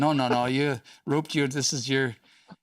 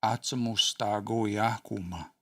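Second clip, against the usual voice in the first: slow careful demo; slower than usual. Shown as a demonstration. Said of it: "I'll throw you a lifeline here".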